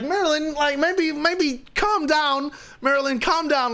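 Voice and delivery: high-pitched, anxious pleading; urgent placating. Retold or imitated. Friends calming guest among families, high placating plea. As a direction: try to settle him, pitched high. Retold hushing of ranting friend.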